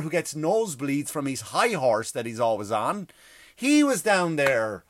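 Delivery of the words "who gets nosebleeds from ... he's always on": ordinary delivery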